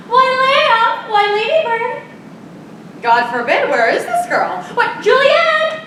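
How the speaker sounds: put-on hunt for the girl, calling her name. Nurse calling for Juliet offstage.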